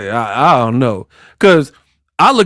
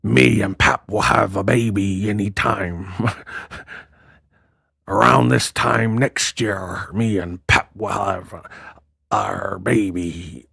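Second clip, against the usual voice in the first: low, quiet pirate drawl; 5 semitones lower, 6 dB quieter, slower than usual. Retold or imitated. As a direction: talk like a hammy pirate. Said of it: "like a pirate".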